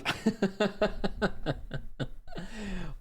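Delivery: long high giggling fit; laughing. Real, not acted. Reader cracks up at the voicemail script, laughing. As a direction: burst into helpless giggles.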